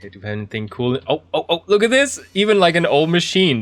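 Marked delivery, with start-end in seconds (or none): 1.1-3.6 much higher, thrilled exclamation; excited. Real, not acted. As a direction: gasp with delight at the find. Finds an old computer.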